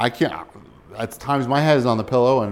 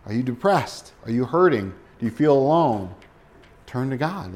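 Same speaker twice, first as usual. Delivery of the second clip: ordinary talk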